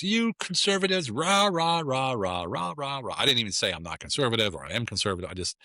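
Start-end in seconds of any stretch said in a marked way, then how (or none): 0.0-3.1 high, slow sneering chant of rah rah; mocking woman. Stranger on a plane mocks him, high sneering chant. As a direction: mimic her jeering at conservatives. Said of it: "She goes".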